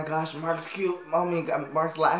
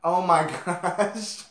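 slow laughing gasp; 4 semitones higher, 4 dB louder, much slower than usual. Real, not acted. Laughing at the clip.

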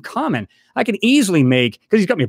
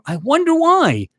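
high, drawn-out mock puzzlement; sarcastic wondering; 9 semitones higher, slower than usual. Real, not acted. Vlogger answering rival's complaint about lost revenue, sarcastic. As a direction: stretch it out high, feigning cluelessness.

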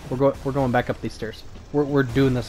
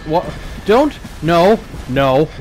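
loud, panicked repeated no; frustrated shouting; 5 dB louder, slower than usual. Real, not acted. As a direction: yell no at the screen. Frustrated shouts at game failing.

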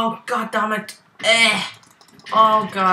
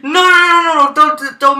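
high frantic panic; being chased; 12 dB louder than usual. Real, not acted. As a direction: shriek while fleeing for your life.

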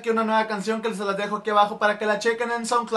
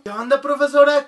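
normal talking voice

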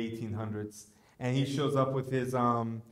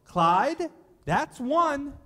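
high, loud, deadpan warning; stern old wife; 8 dB louder, much slower than usual. Retold or imitated. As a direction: warn the donkey coolly, louder and higher. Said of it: "and she's all".

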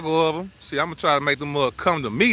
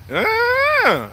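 short high goat bleat; goat bleat imitation. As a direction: call out like a goat. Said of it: "I knew how to speak goat".

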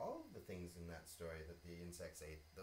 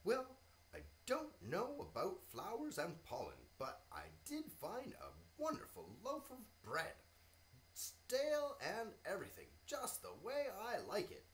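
higher, lively ant voice; cheerful little ant, pitched up; 10 semitones higher, 4 dB louder than usual. Acted. Ant character in story.

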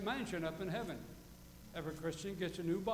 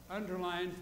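ordinary talk

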